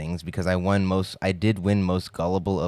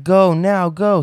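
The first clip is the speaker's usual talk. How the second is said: high, loud frantic go go go; panicked urging; 11 semitones higher, 9 dB louder, slower than usual. Retold or imitated. Friends jumping in the car yelling at the driver, frantic. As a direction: yell at the driver to floor it.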